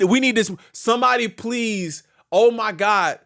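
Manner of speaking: ordinary delivery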